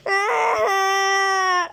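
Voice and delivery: ordinary delivery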